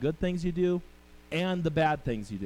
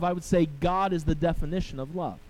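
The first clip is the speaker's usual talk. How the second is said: normal talking voice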